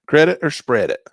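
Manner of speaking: pressing himself for the answer, loud. Real, not acted. Repeated question to himself.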